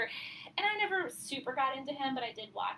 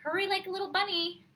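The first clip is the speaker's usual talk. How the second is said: higher, louder sing-out of a hurrying mom; mom hurrying a child; 8 semitones higher, 5 dB louder than usual. Retold or imitated. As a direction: imitate a mother chivvying her little one. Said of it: "I remember my mom saying".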